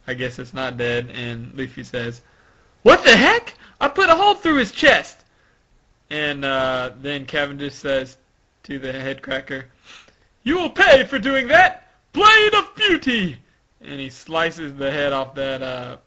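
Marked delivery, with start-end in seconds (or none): 2.8-5.2 very high, loud disbelief; over-the-top anime outburst; acted outraged yell. 10.4-13.4 high, loud vengeful cry; acted attack shout. Acted vow and attack call.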